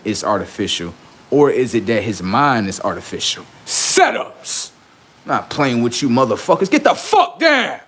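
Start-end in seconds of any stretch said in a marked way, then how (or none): 3.6-4.7 high hoarse yell; shouted catchphrase. Acted. 6.7-7.8 high slow yell; shouting. Acted. Shouting at rivals.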